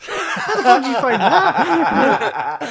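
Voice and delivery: high, loud, slow, laughing disbelief; laughing mock astronaut. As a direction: squeal it through laughter. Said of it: "He's like".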